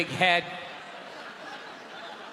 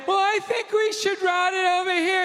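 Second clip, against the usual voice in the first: higher, prissy know-it-all wife voice; mock wife; 9 semitones higher than usual. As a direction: butt in like a meddling wife. Plumber's wife butting in.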